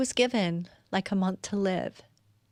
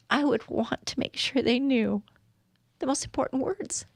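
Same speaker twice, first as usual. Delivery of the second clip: choked up; 4 semitones higher than usual. Real, not acted. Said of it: "I'm getting choked up".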